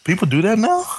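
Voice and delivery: high-pitched feigned disbelief; mock shock. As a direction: play innocent, voice shooting up. Feigning shock at calling in sick.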